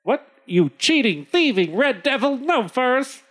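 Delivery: high-pitched indignant trader; hammed-up outrage, rising in pitch; outraged trader. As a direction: climb high in disbelief. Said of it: "The trader says".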